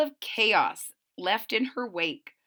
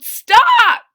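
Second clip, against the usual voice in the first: sudden loud high shout of alarm; parents yelling; 11 semitones higher, 16 dB louder than usual. Acted. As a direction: bark it out to stop the child mid-climb. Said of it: "Her parents yelled".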